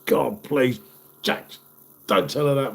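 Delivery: ordinary delivery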